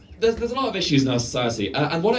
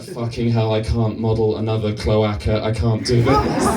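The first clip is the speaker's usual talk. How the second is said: groaning worker; 5 semitones lower than usual. Retold or imitated. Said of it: "the groan around the room".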